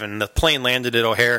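normal talking voice

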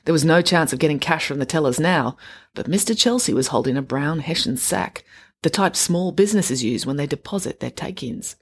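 ordinary delivery, nothing marked

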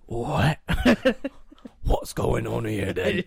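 high, drawn-out London soap voice; hammed-up Cockney disbelief; Phil Mitchell impression. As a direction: stretch it out in a put-on London accent. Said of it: "I can do Phil Mitchell".

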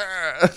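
short, loud, high "rah"; mock monster roar. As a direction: pounce with a playful roar. Acts out leprechaun attacking.

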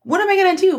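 a hammed-up panicked wail; retold panic. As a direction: blurt it in a sudden panic. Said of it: "I immediately was like".

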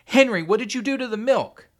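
high, very loud demand; appalled, desperate yell; horrified shout. A narrator's reading. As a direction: scream the question at your son. Horrified mother confronts son.

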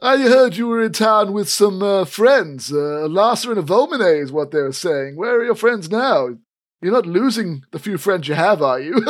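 high, loud smug sneer; smug cousin. Acted. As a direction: gloat over your cousin. GM voices smug cousin Elfgeet.

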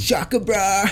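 high mock surfer-bro call; California surfer bro. Friend teases another about his time in California, mock surfer bro. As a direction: put on a California bro accent.

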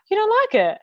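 high, fast, taken aback; gasp, then ask it in real shock; real surprise at his friend's verdict; surprised gasp. Real, not acted. Co-host says the film's crap.

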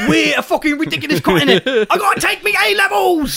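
hammed-up British schoolboy; British accent. British character, high pitch.

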